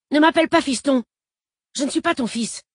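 loud, high-pitched angry rebuke; angry protest. A narrator's reading. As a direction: lash out at the man calling you son. Fils rejette le faux père. (protestation furieuse, aiguë et forte)

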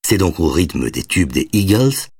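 ordinary delivery, nothing marked

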